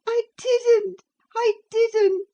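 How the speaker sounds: put-on high, loud heartbroken sobbing girl. A narrator's reading. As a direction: sob it out, heart breaking. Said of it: "sobbed Cathy, fit to break her heart".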